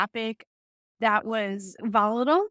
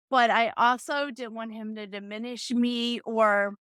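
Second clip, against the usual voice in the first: ordinary delivery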